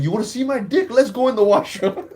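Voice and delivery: heated, high-pitched dare; retold snapping. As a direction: snap and call him out. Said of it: "I just snapped".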